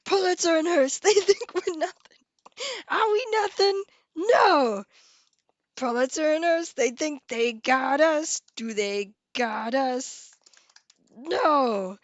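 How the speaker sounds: rally the crowd like a Newsies newsboy in New York accent. Shown as a demonstration. Streamer quoting Newsies to chat in New York accent. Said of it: "I could do a New York accent".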